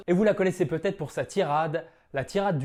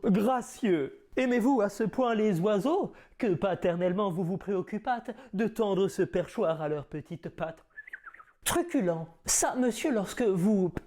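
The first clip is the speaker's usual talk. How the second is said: theatrical Cyrano declaiming; grand stage verse delivery; hammy stage actor; 3 semitones higher than usual. Acted. Performs Cyrano's nose tirade.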